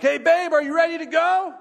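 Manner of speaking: loud, high holler across the house; high, loud love holler. Retold or imitated. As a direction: call out to your wife happily and loudly. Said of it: "it's a love holler".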